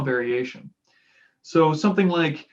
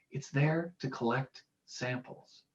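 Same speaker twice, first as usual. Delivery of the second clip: much softer, slow and laid back; relaxed voice; 10 dB quieter, slower than usual. Shown as a demonstration. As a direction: let it drift out easy and slow. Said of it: "this more laid back, relaxed voice".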